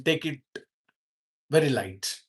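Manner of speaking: ordinary talk, nothing marked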